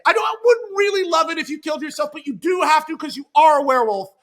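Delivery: fast, loud, high shouted back-pedal. Retold or imitated. Jack shouting fast at David that he has to die. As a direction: rush it out, shouting over him.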